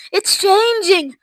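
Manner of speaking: high, drawn-out delighted call; excited shout. A narrator's reading. Violet chewing as the flavour changes, excited mild shout. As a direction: announce it with thrilled wonder. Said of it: "shouted Violet".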